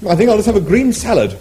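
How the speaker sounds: high appalled Englishman; Arthur appalled. Acted. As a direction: squeal with disgust.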